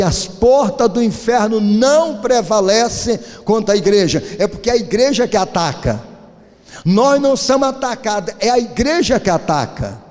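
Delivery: a sermon's rousing climax; preaching. Acted. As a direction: drive the point home to the congregation. Preaching with rising force.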